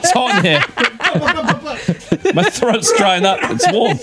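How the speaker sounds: high excuses squeezed out through laughter; hysterical laughing. Real, not acted. Blaming the heat after a slip, laughing hysterically. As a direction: make excuses while helplessly cracking up. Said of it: "laughing hysterically".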